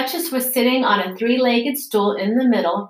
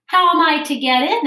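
asked again, raised and louder; 8 semitones higher, 5 dB louder than usual. A narrator's reading. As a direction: push the question out louder. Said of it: "in a louder tone".

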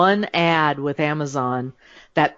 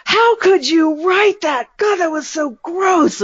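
much higher, slow, louder appalled cousin voice; disgusted cousins; 13 semitones higher, 4 dB louder, slower than usual. Retold or imitated. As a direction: act out relatives horrified by the book. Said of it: "they were sitting looking at me going".

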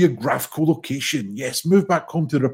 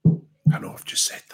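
unvoiced whisper, Welsh-free aside. Real, not acted. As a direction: whisper it under your breath.

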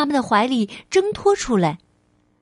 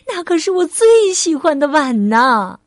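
higher, louder lament of a shocked mother; upset, raised-voice protest; dismayed exclamation; 4 semitones higher, 5 dB louder than usual. Acted. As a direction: cry out over a treasured thing. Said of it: "妈妈惊呼起来".

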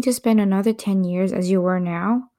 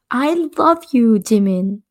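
crying out; 3 semitones higher, 4 dB louder, slower than usual. Acted. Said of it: "you cried".

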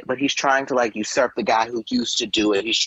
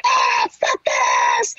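hammed-up outburst of rage; mock angry yell; 12 semitones lower, slower than usual. Imitating fired TV host watching his replacement, mock-furious. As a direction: imitate an actor losing it. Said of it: "he's mad".

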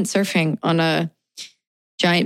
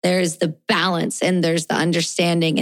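usual speaking voice

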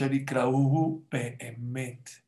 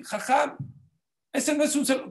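mock child voice; 11 semitones higher than usual. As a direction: chirp it like an eager pupil.

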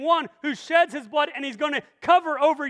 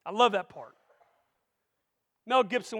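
normal talking voice

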